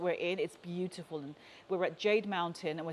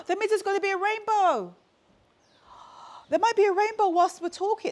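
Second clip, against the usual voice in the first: sudden high, loud excitement; excited; 12 semitones higher, 6 dB louder than usual. Real, not acted. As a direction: gasp and get thrilled about the rainbow. Gasps at a possible rainbow.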